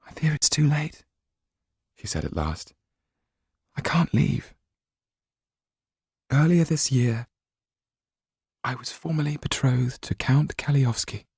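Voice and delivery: high, resigned voice; a touch of sorrow; sad young woman. A narrator's reading. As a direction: sadly admit defeat. Sido's dialogue.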